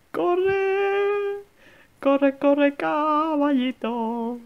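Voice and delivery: high excited urging; being chased. Real, not acted. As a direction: cheer it on to run.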